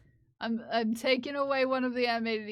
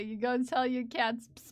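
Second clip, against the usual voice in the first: usual speaking voice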